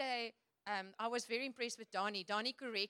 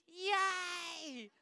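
mock cheer; 7 semitones higher than usual. Mock cheer after slogan.

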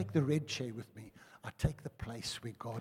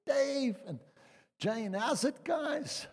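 higher, bright imitation of an old schoolmate; cheery greeting; 11 semitones higher, much slower than usual. Schoolmate greets the couple at reception, cheerful. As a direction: call out a cheery hello. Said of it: "there he is".

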